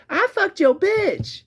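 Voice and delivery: very high, drawn-out taunting yell; slow, shrieky mock taunt; imitated kid's scream. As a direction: mimic a kid yelling from up a hill. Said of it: "screaming some inflammatory shit at me".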